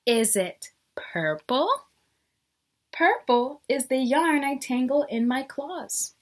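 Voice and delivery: lively storybook reading with rising questions; teacher reading a picture book to the class; reading to children. Acted. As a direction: read each guess like a curious cat. Reads the picture book aloud.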